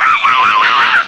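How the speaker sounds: high, loud preacher's cry; shouting preacher impression. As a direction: yell it like a fired-up evangelist. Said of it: "Mereka semua dua-duanya". (tiruan pengkhotbah yang berteriak tinggi)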